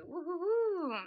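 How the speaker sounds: usual speaking voice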